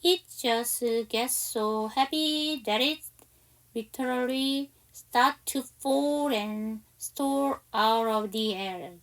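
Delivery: slow, drawn-out English read-aloud; model reading. Shown as a demonstration. Teacher reads the next English sentence to students, slow model reading. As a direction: read slowly, stretching words for learners to follow.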